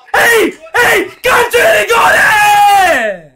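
drawn-out high shout of alarm; excited shout. Real, not acted. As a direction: yell it at the screen. Penalty given, shouting.

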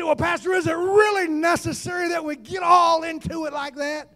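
high-pitched imitation of a sceptical churchgoer; doubting congregant. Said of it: "You say".

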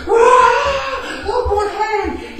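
screaming. Retold or imitated. Showing off to the girl, screaming wildly. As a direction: scream it like you're invincible. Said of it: "screaming and screaming".

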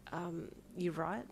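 slow, soft raspy question; just woken, rasp it quietly. A narrator's reading. Parent woken from a nap asks child, raspy. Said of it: "in a raspy voice".

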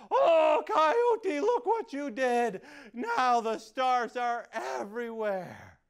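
high loud dismayed reproach; upset scolding. Acted. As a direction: scold him for the mess. Creator scolds coyote.

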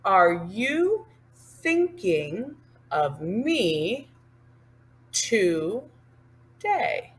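very slow, word by word; slow spoken demo. Shown as a demonstration. Teacher pacing words to hand signs, slow speech. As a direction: say it with gaps between words.

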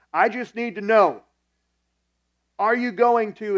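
acting out a loud, high, irate boss; angry confrontation. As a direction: shout it slowly, demanding an answer. Angry boss confronting him, acted.

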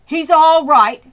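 loud, high reassuring cry; anxious farmer crying out; cried out. A narrator's reading. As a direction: shout it to calm the crowd. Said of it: "cried Mister Zuckerman".

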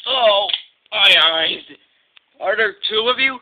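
loud, bewildered exclamation; astonished cry. Acted. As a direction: cry out as if seeing double. Diesel bewildered at seeing double.

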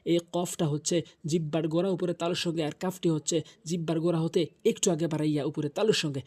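ordinary talk, nothing marked

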